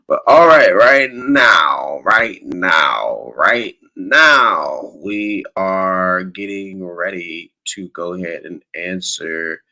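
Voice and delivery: loud, drawn-out show host announcing; announcer hype. Real, not acted. Host launching listener questions, loud hype announcing. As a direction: belt out the segment intro, stretching the words.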